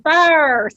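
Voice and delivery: imitated Southern FIRST, drawn out; Southern pronunciation. Host teases the guest about her accent, Southern FIRST. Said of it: "you are getting more Southern as you talk".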